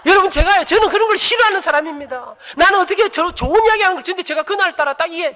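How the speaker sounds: high, loud, laughing pulpit voice; loud laughing preaching. Acted. (웃으며 높고 크게 외치는 설교)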